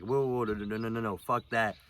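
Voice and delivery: snapping back. Retold or imitated. Refusing his girlfriend's blame, a loud retold snap. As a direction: cut her off hard with whoa, whoa. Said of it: "I snapped my original voice".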